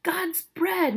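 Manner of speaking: high-pitched exasperated oath; acted frustration, pitched right up; angry outburst. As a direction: burst out as a fed-up father. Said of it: "meaning angry or frustrated".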